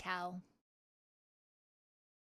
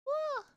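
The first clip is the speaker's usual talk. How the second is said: a very high squeaky moo attempt; attempted cow call. Shown as a demonstration. Trying out a cow accent for chat, very high and squeaky. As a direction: try to moo but land on a meow. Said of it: "That sounded more like a cat".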